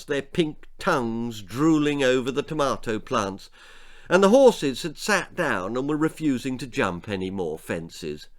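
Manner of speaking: plain reading voice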